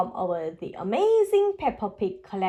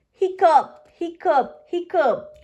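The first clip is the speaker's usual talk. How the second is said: hiccups; 6 semitones higher, 5 dB louder than usual. Acted. George hiccuping repeatedly.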